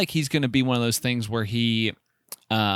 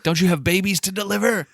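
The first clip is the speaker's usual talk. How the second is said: high, louder mock jeer at the stork; mock taunt; 9 semitones higher, 3 dB louder than usual. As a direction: sneer it out in a hammed-up high voice. Mocking a stork in joke scene.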